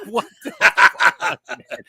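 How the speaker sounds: high, slow, laughing disbelief; horrified disbelief. Retold or imitated. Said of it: "I'm like".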